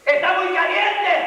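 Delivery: high shouted complaint of a demon; acted demon's high-pitched cry; demons screaming. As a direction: cry out in protest. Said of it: "ellos gritan".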